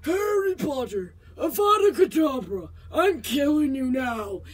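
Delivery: hammed-up Voldemort killing Harry. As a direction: threaten Harry slowly like a breathless dark lord. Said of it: "a face of Voldemort going".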